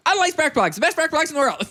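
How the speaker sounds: put-on old-timey radio ad announcer; 1930s radio ad voice. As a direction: sell it like a vintage radio spot. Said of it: "No, it goes like this".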